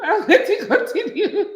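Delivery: laughing through the words; laughing reply to continue. Real, not acted. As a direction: giggle it out. Laughs while agreeing.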